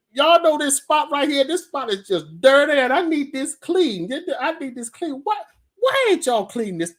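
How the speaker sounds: high, loud Southern owner's voice; Southern lady. Retold or imitated. Restaurant owner ordering a dirty spot cleaned, mock Southern accent. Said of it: "the whole southern".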